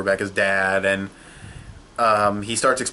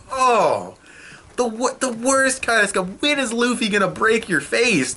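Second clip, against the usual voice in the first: high, worked-up rant; exasperated outburst; 11 semitones higher, slower than usual. Real, not acted. Reviewer fuming at Doflamingo, high and worked up. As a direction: vent at the villain, pitch climbing.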